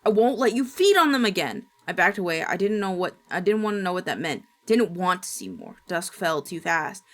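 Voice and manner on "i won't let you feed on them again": loud enraged grandma; furious scream. Acted. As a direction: scream it in rage. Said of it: "Then she screamed".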